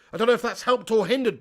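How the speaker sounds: ordinary delivery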